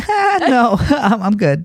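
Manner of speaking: high laughing nervous no; nervous laughing refusal. Retold or imitated. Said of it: "I was like".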